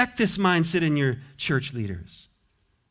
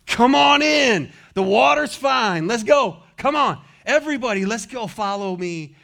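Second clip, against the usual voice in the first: rousing call; 6 semitones higher, 4 dB louder than usual. Acted. Preacher urging the congregation to join, rousing call. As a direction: wave the crowd in with a big shout. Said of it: "What's he saying?".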